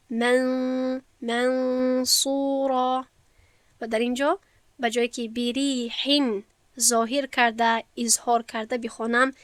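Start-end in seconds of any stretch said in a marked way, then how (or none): 0.1-3.1 منصورا chanted very slowly, nun hidden in a nasal hum; recited منصورا with long ghunna; ikhfa recitation. Shown as a demonstration. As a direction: draw out منصورا with the nun through the nose. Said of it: "بگویم".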